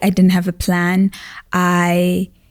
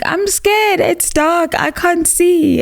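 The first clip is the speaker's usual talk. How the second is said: put-on high, small voice of a frightened child; imitating herself as a scared child, much higher; child's scared voice; 10 semitones higher than usual. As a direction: squeak it out like a scared little kid. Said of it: "where I'm like".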